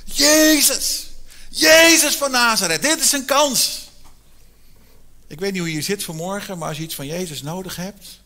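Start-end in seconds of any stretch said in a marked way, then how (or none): 0.0-3.9 high, loud desperate shout; shouting louder. Retold or imitated. Blind man shouts over the hushing crowd for Jesus, loud desperate shout. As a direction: cry out with everything you have. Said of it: "Dus hij gaat nog harder schreeuwen".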